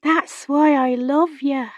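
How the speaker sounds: put-on high, slow girlish voice; tender young girl. A narrator's reading. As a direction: say it slowly and high, a child's confession. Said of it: "touched a finger to the woman's cheek and whispered".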